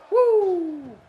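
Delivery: shrill showman's woo; Flair woo yell. Pretend. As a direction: let out a ring-style woo. Wrestler woo.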